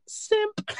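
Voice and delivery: very high-pitched squeal of a word; mock call-out. Host reading his own note about Ted, mocking squeal. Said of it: "I wrote again in my notes".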